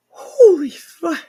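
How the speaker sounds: overwhelmed, tears spilling out; crying out. Real, not acted. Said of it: "a blubbering fool right now".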